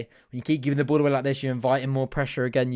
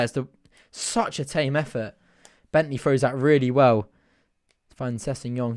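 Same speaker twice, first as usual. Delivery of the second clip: ordinary talk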